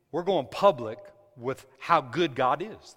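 ordinary delivery

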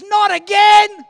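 high, loud, drawn-out complaint; acted brother's fed-up outburst; exasperated yell. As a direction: groan it out at a shout. Brother exasperated at being prayed for.

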